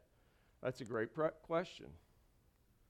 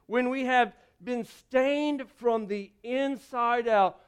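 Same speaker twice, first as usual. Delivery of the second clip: louder, building sermon cadence; preaching; 3 semitones higher, 7 dB louder than usual. Acted. Pastor urging the congregation to let Jesus in, preaching loudly. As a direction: preach it with rising force.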